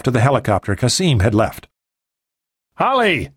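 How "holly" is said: called out high across the field; much higher calling out; shouted. A narrator's reading. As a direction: call her name over the noise. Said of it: "Tsang shouted".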